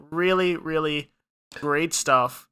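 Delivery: usual speaking voice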